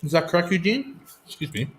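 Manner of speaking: talking through a mouthful; mouth full. Real, not acted. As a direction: speak around a bite of food. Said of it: "talk with your mouth full".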